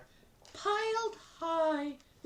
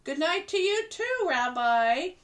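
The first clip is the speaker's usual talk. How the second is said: higher, cheerful grandmother voice; grandma Bubba; 12 semitones higher, 4 dB louder than usual. Acted. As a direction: play the kindly grandma talking to her guest. Bubba speaks to bear.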